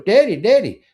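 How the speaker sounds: lightly imitated daughter calling Daddy; child calling out. Retold daughter calling to father.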